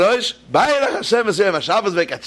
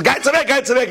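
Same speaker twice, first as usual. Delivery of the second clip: urgent warning; 9 semitones higher than usual. Acted. Bystanders warn drunks off tracks.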